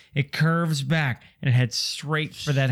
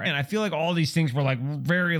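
ordinary talk

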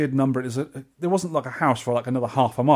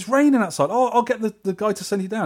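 high, louder imitation of a concerned host; fussing hotel lady; 8 semitones higher, 3 dB louder than usual. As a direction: act out her alarmed, helpful offer.

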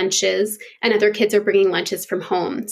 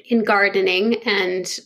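usual speaking voice

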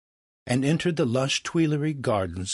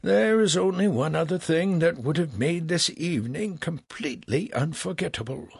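falling tone; 8 semitones higher than usual. A narrator's reading. Franklin hints at a wish to Legras, regretfully falling. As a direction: let the wish fade away sadly. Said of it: "Franklin continued with a falling tone".

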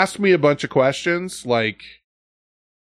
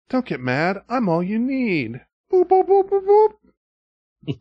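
sing-song gadget chirping boops; robot vacuum voice; 11 semitones higher than usual. Retold or imitated. Robot vacuum to its angry owner, cutesy beeping voice. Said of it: "robot vacuum cleaner's like".

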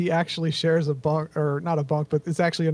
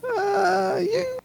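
nervous squeak; 13 semitones higher than usual. Acted. Everly hesitates, nervous villager.

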